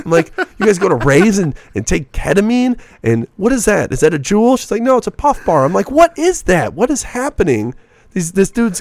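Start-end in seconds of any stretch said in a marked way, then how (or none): 0.6-4.6 baffled disbelief. Retold or imitated. Retold baffled reaction at rave party. 4.8-5.6 mock girl voice. Girl's reply voiced. 5.9-7.7 incredulous. Retold or imitated. Retold shock at party.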